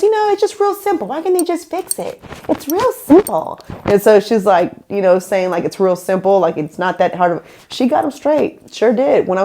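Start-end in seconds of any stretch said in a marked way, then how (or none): 0.0-3.6 much higher, quick, airy imitation of Jessica; breezy Jessica. Mimicking Jessica brushing off the hotel mess, high and breezy. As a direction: toss it off like it's nothing, pitched way up.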